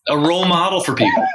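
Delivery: giggling through the words; can't hold back laughing. Real, not acted. Laughs mid-sentence at own bit.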